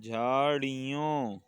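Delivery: झाड़ियों stretched at half speed; teacher's slow, careful झाड़ियों with a falling end; slowly drawn out. Shown as a demonstration. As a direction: draw out each syllable of झाड़ियों. Teacher models word for class.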